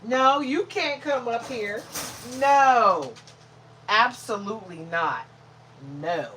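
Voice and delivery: loud, slow, emphatic scolding; firm refusal. Real, not acted. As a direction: lay down the law to a dog. Refusing to let dog climb up.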